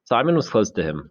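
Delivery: usual speaking voice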